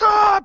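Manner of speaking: loud, very high shriek; terrified high-pitched "ah"; retold scream. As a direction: scream bursting into the bedroom. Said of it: "I just started screaming".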